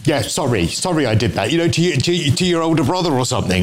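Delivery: ordinary delivery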